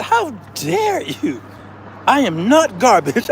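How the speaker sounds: high, slow hammed-up outrage; mock offended waitress. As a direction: play the insulted waitress. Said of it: "She said, she said".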